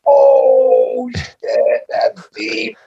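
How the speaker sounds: retold gasp of awe, high then breathy and hushed; amazed reaction. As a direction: relive the moment the line floored you. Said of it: "And I was like".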